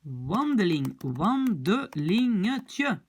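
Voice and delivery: wandelingetje said very slowly for learners; drawn out, syllable by syllable; slow model word. Shown as a demonstration. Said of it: "model word".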